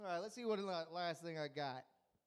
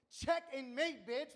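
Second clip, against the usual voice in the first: gloating; 5 semitones higher, 5 dB louder, slower than usual. Real, not acted. Gloating about his comeback insult.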